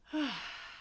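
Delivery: audible relaxing sigh; breathy release sigh; big sigh. Shown as a demonstration. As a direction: let out a big old sigh. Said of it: "have a big old sigh".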